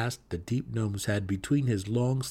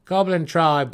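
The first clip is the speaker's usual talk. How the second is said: grim scout's report; 7 semitones higher, 10 dB louder, slower than usual. Acted. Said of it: "the Svirfneblin scout replied".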